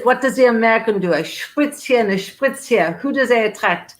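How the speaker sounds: mock-question it like a French storyteller; put-on French accent for a retold quote. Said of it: "my French accent".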